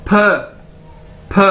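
isolated "फ" with a puff of air; teacher modelling the sound "फ"; demonstrated pronunciation. Shown as a demonstration. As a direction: sound out "फ" alone, then pause. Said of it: "पी को हम as a फ".

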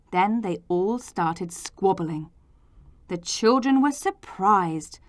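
usual speaking voice

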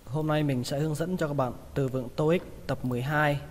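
normal talking voice

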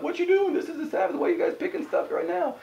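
high-pitched, mocking imitation of scolding critics; indignant Pharisees. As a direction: squeak out accusing questions in a put-on high voice.

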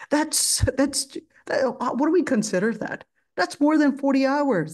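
indignant protest. Retold or imitated. Protesting the maid's hours.

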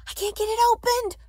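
very high, loud panic; frantic. A narrator's reading. Ellen struggling with the clasp as soldiers near, frantically. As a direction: strain upward in helpless alarm. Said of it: "Ellen said frantically".

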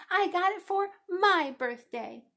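slow, high, loud insistence with MY punched; drawn-out child's yell. A narrator's reading. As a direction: yell it, leaning hard on "my". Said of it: "she yelled".

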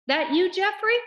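high, loud questioning call. Acted. Amanda calls to Jeffrey from the curb, calling out. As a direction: call over to check who it is. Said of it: "A voice called".

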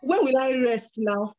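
high-pitched, put-on pleading; mock whining complaint. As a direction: complain up high like an overworked servant. Acts out complaining to God.

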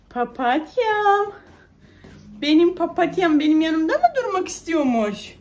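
loud, singsong pet-name cooing. Real, not acted. Mother coaxing her baby to stay, singsong baby talk.

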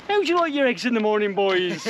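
quick, high cafe lady with a put-on accent; Barbara. Cafe owner asking boys about eggs, hammed-up local accent. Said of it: "that's the best accent I can come up with".

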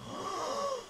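gasping, very high "What"; startled gasp. Real, not acted. Player startled by a sudden scene, gasping. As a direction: catch your breath in shock.

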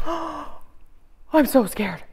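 hammed-up fear with a gasp; mock scared. Shown as a demonstration. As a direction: play frightened for the kids. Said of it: "What's your scared face?".

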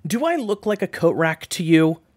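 high, very loud protest; indignant shout. A narrator's reading. June scolds friend over tossed clothes, indignant shout. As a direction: yell it in high-pitched outrage.

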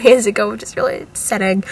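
ordinary talk

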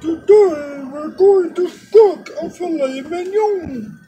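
very high, slow, sing-song cooking-show voice; Julia Childs impression. TV chef announcing today's dish, high slow impression. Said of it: "That's my Julia Childs impersonation".

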